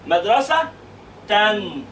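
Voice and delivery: MADRASA-TAN, split before a held "tan"; fath plus noon. Shown as a demonstration. Teacher breaks down the word for pupil, MADRASA, pause, TAN. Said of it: "فتحة ونون".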